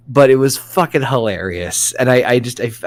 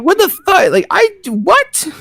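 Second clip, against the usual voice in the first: high, incredulous squeak; mock disbelief; 4 dB louder than usual. Dad recalls gawking at kid's abs, high and incredulous. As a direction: act stunned by the kid's abs. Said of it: "I'm like".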